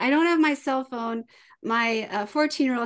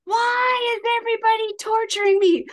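high, louder crying whine, slowing at the end; dramatic kid wail; 10 semitones higher, 6 dB louder than usual. Retold or imitated. Mom imitates her six-year-old's dramatic meltdown, crying loudly. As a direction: wail like a child sure the world is against him. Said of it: "so he's like".

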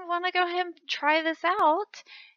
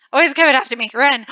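panicked shout; 11 dB louder, faster than usual. Real, not acted.

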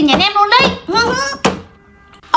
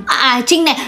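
ordinary talk, nothing marked

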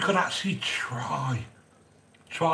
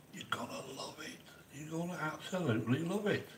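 whisper; 2 semitones higher, 14 dB quieter than usual. Acted. Coaxing viewers to try recipe.

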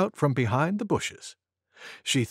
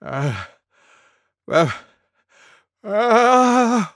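spaced gasps growing higher and louder; sneeze wind-up; 7 semitones higher, 6 dB louder, much slower than usual. A narrator's reading. Elephant about to sneeze, mounting gasps. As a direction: build towards a huge sneeze. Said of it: "went Elephant".

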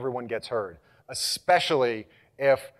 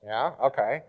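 normal talking voice

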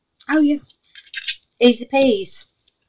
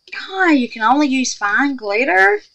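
incredulous; 9 semitones higher, 4 dB louder than usual. Retold or imitated. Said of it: "I was like".

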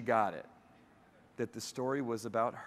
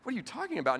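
lightly voiced mom, higher, caught off guard; a touch of annoyed confusion; mild mock fluster from a puzzled mother; 11 semitones higher than usual. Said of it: "flustered and a little annoyed with me".

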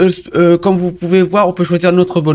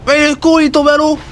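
high-pitched exasperated complaint; exasperated outcry; 10 semitones higher, faster than usual. Real, not acted. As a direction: cry out in frustration at the mishap. Falls in the water, swears.

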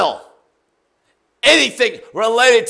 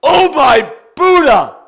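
shocked exclamation; 6 semitones higher, 6 dB louder, slower than usual. Real, not acted. Exclaims after realizing trick.

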